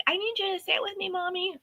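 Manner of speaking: put-on high, soft little-kid voice; little child. Small child asking mother for company, imitated little-kid voice. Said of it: "little ones who are like".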